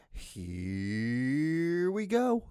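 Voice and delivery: very slow, stretched rising intro; drawn-out launch. Real, not acted. Host launches video of the week, drawn-out announcement. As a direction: drag out the first word like a long wind-up.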